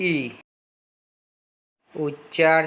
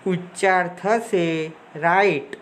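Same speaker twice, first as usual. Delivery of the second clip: ordinary talk, nothing marked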